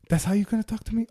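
high, soft disbelief; retold hushed indignation; stunned offence. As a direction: ask it under your breath, affronted. Retold stunned reaction to cop's rebuke.

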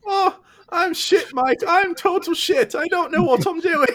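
very high, wailing self-loathing cartoon voice; Toxic Morty. Morty putting himself down to Mike, high wailing impression. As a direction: squeal in mock despair. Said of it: "That's more like Toxic Morty".

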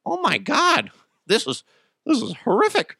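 high mock-horrified gasping; shocked audience. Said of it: "people are going".

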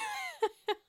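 high giggle; a quick giggle; laughing at a sweet moment. Real, not acted.

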